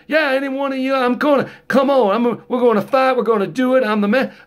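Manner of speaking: high, pumped-up rapper challenge; mock tough guy. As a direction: act out a drunk itching for a fight. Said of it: "He decides".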